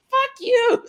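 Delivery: very high, loud squeal; hammed-up squeaky outburst; mock indignant mom. Acts out mom's indignant retort.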